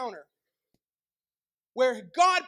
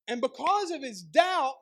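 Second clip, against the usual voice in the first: usual speaking voice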